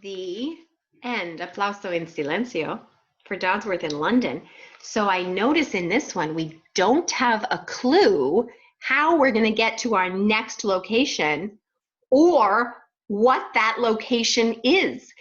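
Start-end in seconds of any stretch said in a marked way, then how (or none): 6.3-15.1 slow, drawn-out and louder, building suspense. Acted. Teacher teasing her class about the next place, slow and suspenseful. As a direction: stretch it out like a mystery.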